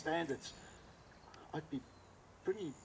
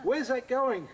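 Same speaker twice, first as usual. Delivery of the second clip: high, loud alarmed question; incredulous demand; 12 semitones higher, 9 dB louder, much slower than usual. Retold or imitated. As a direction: act out calling across in disbelief. Acted alarmed question about the truck.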